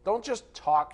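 ordinary talk

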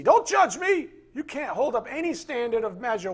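high, loud mock whine; mocking whiny protest. Mocking the offended churchgoer, high and loud. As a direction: squeal it like an offended brat. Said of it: "It's not P C".

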